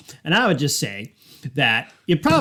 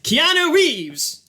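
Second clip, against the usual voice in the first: high drawn-out call; 12 semitones higher, much slower than usual. Acted. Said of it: "Logan's gonna just be like".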